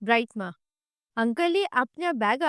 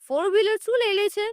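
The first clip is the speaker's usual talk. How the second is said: angry shout; 7 semitones higher than usual. Acted. Young woman yelling back at a rude driver, angrily.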